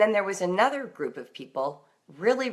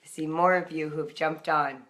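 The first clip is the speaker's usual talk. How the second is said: ordinary talk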